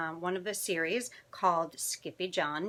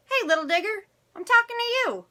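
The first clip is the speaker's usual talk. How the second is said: high, louder mock scolding; scolding mama; 14 semitones higher, 8 dB louder, faster than usual. Mama to her little digger, high-pitched scolding. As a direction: pitch it way up like an exasperated mother.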